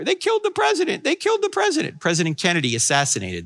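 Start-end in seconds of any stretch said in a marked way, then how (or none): 0.0-2.0 high, strained voice of a distraught mother; mother's broken voice, high; weeping mother. Retold or imitated. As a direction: play the grief-stricken mother, much higher. Mother voiced weeping over Kennedy.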